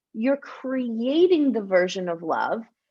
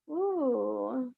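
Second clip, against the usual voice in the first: dreamy ooh; 8 dB quieter than usual. Shown as a demonstration. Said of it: "in the vibe of this".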